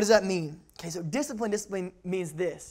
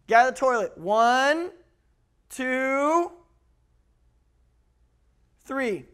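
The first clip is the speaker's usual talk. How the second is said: loud, stretched "One", "two", long wait before "three"; drawn-out cadence; 6 semitones higher, 7 dB louder, much slower than usual. Shown as a demonstration. Child mimics lax parent's slow count, drawn-out cadence. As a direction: drag "One" and "two" upward, then drop "three". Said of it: "You learn your parents' cadence".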